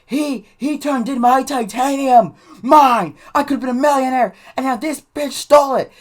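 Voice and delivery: high, loud wailing accusation; mock out-of-control outburst. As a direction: imitate a bawling kid ranting at the principal. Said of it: "Andy was just out of control".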